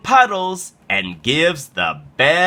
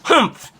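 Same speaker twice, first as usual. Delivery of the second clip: short, high huff of annoyance; acted grumpy humph; indignant humph; 13 semitones higher, 3 dB louder than usual. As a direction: huff like a thwarted bully. Bully llama annoyed.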